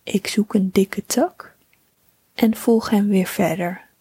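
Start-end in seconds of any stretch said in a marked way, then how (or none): none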